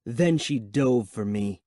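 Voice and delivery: ordinary delivery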